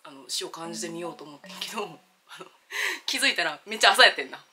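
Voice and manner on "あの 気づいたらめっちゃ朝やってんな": ordinary delivery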